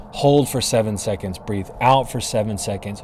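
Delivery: ordinary talk, nothing marked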